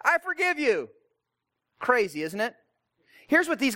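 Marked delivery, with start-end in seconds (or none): 0.0-0.9 bystander Harry. Retold or imitated. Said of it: "Harry looks at Tom and says".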